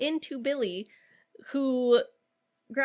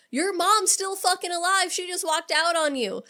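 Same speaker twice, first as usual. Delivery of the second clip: mock angry rant; 8 semitones higher than usual. Stu's angry rant retold.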